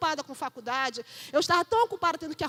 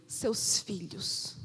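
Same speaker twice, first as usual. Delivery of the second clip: whisper; 8 semitones lower, 5 dB quieter, much slower than usual. Acted. Pleading with parents in sermon.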